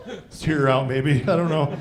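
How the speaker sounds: usual speaking voice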